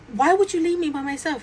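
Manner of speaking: high-pitched retold protest; acted-out hurt outrage; indignant. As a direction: accuse someone of abandoning you. Retold quarrel with cousin, still mad.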